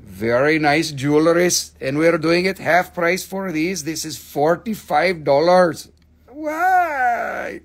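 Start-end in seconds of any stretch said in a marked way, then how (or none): 6.3-7.6 high, shouted mock why; mock outrage. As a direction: protest the price in jest. Cries out at low price.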